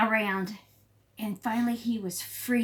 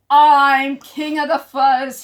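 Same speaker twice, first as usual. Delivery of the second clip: loud, slow snarled boast; snarling king; 5 semitones higher, 13 dB louder, slower than usual. Acted. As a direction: gloat like a bully king. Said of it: "he snarled".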